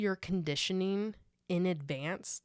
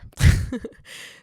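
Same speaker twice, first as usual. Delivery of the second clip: loud laugh; 13 dB louder than usual. Real, not acted. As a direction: burst of laughter. Laughs at own joke.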